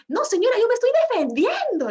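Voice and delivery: high, loud self-justifying protest; indignant neighbor. Retold or imitated. Neighbor answering the lady while kicking the thief, high loud protest. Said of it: "le decía".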